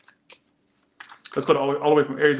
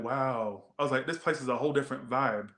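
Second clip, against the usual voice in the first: dry reaction; 2 semitones lower, 8 dB quieter than usual. Retold or imitated.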